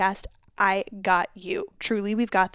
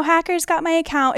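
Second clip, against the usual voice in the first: mock fake post; 7 semitones higher, 4 dB louder than usual. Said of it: "says, you know".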